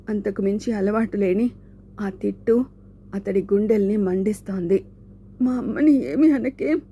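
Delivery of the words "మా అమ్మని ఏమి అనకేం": a strained, pitched-up plea; higher tearful plea. Acted. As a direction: beg him to spare your mother. Shanku pleading for his mother. (అమ్మను తిట్టొద్దని వేడుకుంటూ)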